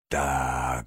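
low, hushed, drawn-out threat; menacing. A narrator's reading. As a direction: say it ominously, deep and soft. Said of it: "грозно промолвил мистер Барсук". (грозно, низко и протяжно)

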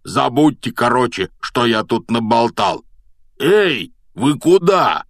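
urgent high call at the end; alarmed calling out. A narrator's reading. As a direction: blurt it out, then holler after them. Hagrid alarmed, calls after them.

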